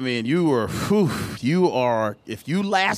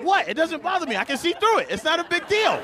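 high-pitched mock indignation; comic self-defence; defensive protest; 10 semitones higher than usual. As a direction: protest it's harmless, voice climbing. Defending himself to girlfriend.

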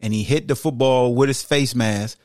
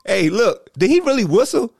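higher, eager appeal to the referee; mock player to ref; 10 semitones higher, 3 dB louder than usual. Host mimicking the guard asking the ref, higher and eager. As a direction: act out pleading with the ref. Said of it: "did you wave at the ref like".

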